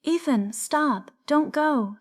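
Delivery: high, pleading shout; panicked cry. A narrator's reading. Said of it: "she cried".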